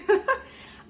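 short high giggle; high squeaky laugh. Real, not acted. Laugh after bruising joke.

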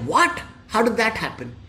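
mildly acted shock; startled disbelief. Ballu hearing that Rajesh is dead, startled disbelief.

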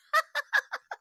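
high staccato laugh. Real, not acted. Host laughing at their own Cahuenga joke, high laugh. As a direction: burst into a short high giggle.

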